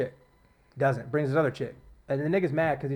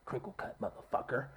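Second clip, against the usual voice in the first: whispered; 5 semitones lower, 14 dB quieter than usual. Retold or imitated. Retelling the heroine's demand to chef.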